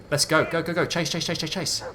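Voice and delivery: urgent urging. Real, not acted. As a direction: spur the dog into the chase. Urging the dog to chase.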